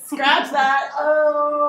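laughing mock retraction.

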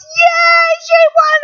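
very high, loud cheer; cheering crowd. Retold or imitated. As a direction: cheer like the whole stadium. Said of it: "everybody just erupts".

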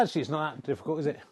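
usual speaking voice

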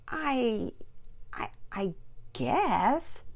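put-on halting, drawn-out unsure child; trailing off. A narrator's reading. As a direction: falter and let it trail away. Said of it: "Penelope's voice trailed off".

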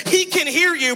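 lightly voiced evil voice, pitched high; evil voice in head. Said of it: "I hear a second evil voice in my head".

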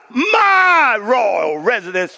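slow, proud shout; boastful king. Acted. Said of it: "here's arrogance".

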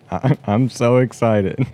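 slow deadpan fake excitement; flat fake excitement. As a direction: drag out a bored so excited. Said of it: "There's a lot of excitement there".

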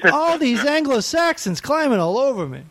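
put-on high kvetching Yiddish accent voice.

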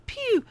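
high squeaky pew noise; little firework pop; pew pew sound. Retold or imitated. Mocking small fireworks.

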